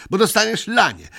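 put-on child voice shouting a threat; menacing shout. A narrator's reading. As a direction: threaten a beating in a high cry. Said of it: "krzyknął groźnie Migotek".

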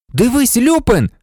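high, urgent call; exclamation. A narrator's reading. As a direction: exclaim to a friend. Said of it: "вигукнув Гаррі". (схвильований вигук)

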